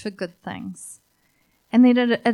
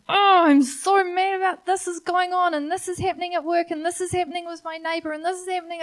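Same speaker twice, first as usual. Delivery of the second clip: high, rushed mock complaint; whinging; 8 semitones higher, 4 dB louder, faster than usual. Preacher mimics complainers, high whiny rant. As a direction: whine it fast, piling up gripes. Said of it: "it's been whinges".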